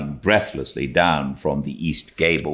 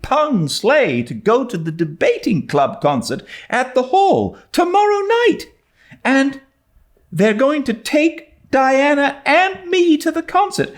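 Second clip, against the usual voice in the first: put-on much higher and louder, bubbling girl; a girl who can hardly contain herself; overjoyed young Anne, laughing at the end; breathless excited Anne; 5 dB louder than usual. A narrator's reading.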